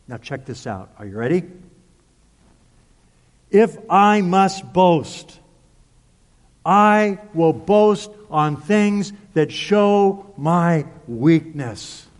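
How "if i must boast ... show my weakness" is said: very slow, weighted scripture line; deliberate, drawn-out proclamation; emphatic preaching. Acted. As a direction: stretch each word out for the climax. Solemn climax of scripture reading.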